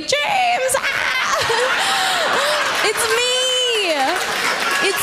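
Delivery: high, shrieking call of James with laughing screams; excited screaming fan. Retold or imitated. Woman spotting James in a police lineup, shrieking high. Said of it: "I'd be like".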